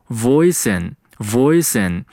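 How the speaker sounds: "voice in" slowly joined, s sliding into in; teacher's slow linking demo of "voice in"; linked pronunciation. Shown as a demonstration. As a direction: run "voice in" together like "voi-sin". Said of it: "voice和in有一个连读".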